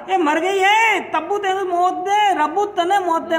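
loud angry yell at his wife; slow, loud cursing shout; shouting angrily. Retold or imitated. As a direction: hurl the curse loudly. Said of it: "चीख कर बोला".

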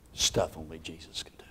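breathy whisper, awed; awed whisper of Stuff only Jesus can do. Shown as a demonstration. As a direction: whisper it to the room. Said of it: "repeated as whisper".

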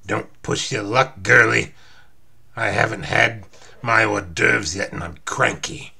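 Wolverine, cranky. Acted. Hungry hero warning the girl, cranky. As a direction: growl it slowly and forcefully.

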